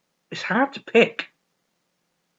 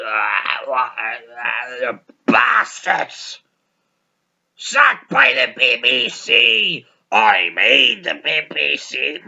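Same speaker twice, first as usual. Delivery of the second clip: hammed-up slurred drunk shouting; slow, drawn-out drunken bawl; drunken rant; 3 semitones lower, slower than usual. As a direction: imitate a bitter drunk wailing. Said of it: "And he's drunk".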